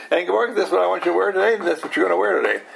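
rapid, insistent dictating voice; hurried mock command to Ingeborg; fast bossy order. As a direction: fire the order off fast and firm. Repeated bossy order, faster.

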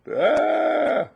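short high shouted "Ah"; loud sharp cry. Real, not acted. Sudden exclamation mid-question.

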